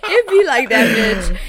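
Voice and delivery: high, loud laughing exclamation. Real, not acted. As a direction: shout it through laughter. Laughing reaction to job.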